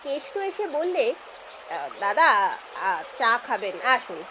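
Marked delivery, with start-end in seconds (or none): 1.7-4.2 lightly voiced, lower-pitched young neighbour; low, halting, polite invitation; neighbour's son. Neighbour's son inviting the visitor to tea, lightly voiced and low.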